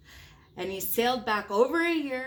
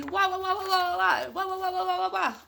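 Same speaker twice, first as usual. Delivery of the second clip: playful high wah chant; 9 semitones higher, 5 dB louder, faster than usual. Acted. Wild rumpus chant.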